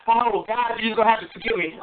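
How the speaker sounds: usual speaking voice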